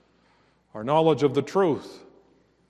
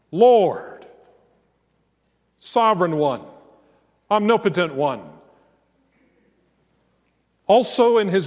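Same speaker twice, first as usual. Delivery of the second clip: raised, ringing preacher's voice; fervent pulpit proclamation; preaching at full force; 4 semitones higher, 4 dB louder than usual. Acted. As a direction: proclaim his glory to the congregation.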